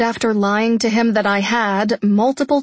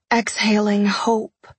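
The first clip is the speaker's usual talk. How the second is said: ordinary delivery, nothing marked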